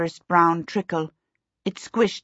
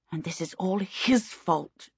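a slow, softer snarl under her breath; snarled to herself; 6 dB quieter than usual. A narrator's reading. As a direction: grumble it bitterly to herself. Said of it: "she snarled to herself".

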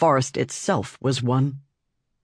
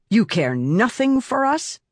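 snapped; 9 semitones higher than usual. A narrator's reading. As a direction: bite the words off angrily. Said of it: "Silence snapped".

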